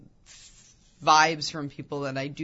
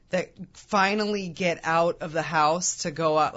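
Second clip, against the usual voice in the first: usual speaking voice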